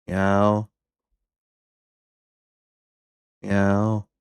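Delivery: settling back into lazy cat meows. Pretend. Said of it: "That's as a cat".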